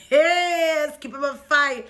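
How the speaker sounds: loud excited reaction. Real, not acted. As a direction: react loudly with glee.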